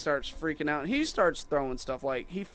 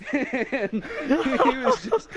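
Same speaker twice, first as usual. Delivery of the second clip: high-pitched laugh breaking through the words; cracking up mid-story; 14 semitones higher, 6 dB louder, much slower than usual. Real, not acted. Laughing while retelling the rant.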